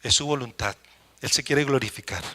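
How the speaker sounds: hushed promise spoken over the congregation; quiet personal declaration. Pretend. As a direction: say it softly as your own prayer. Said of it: "su nombre, diga".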